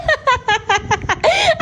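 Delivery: high, bursting cackle of delight; delighted laughter. Real, not acted. Amused that child posed after all. (malakas na halakhak sa tuwa)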